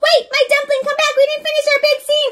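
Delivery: high, pleading voice calling her dumpling back; pleading sweetheart. Acted. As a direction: call after your runaway fiancé. Miss Van Muffel calls him back.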